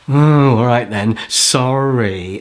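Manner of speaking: slow, drawn-out grudging sorry; insincere apology. Acted. Games player told to apologise, slow and insincere. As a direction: drag out a put-on apology. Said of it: "I want to hear it like you mean it".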